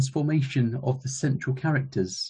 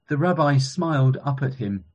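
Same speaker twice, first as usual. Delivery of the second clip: ordinary talk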